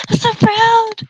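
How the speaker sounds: over-the-top fake pride; mock proud gush. Mock praise, louder.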